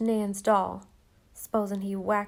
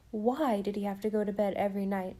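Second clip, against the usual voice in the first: plain reading voice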